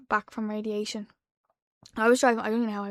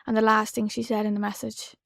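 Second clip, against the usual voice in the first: ordinary delivery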